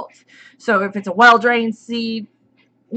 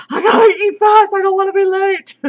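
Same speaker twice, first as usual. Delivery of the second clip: hurried panic; 10 semitones higher, 5 dB louder than usual. Retold or imitated. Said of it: "I was like".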